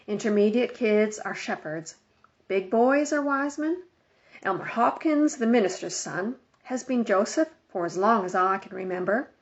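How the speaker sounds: ordinary delivery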